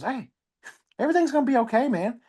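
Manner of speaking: put-on sing-song kid talking up high; dopey little kid. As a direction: play the clueless little girl consoling dad. Four-year-old daughter voiced.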